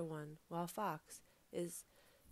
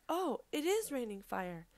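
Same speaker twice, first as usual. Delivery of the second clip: loud, high alarmed exclamation; panicked realization; 5 semitones higher, 7 dB louder than usual. A narrator's reading. Fox feels stings and believes fire falls, panicked.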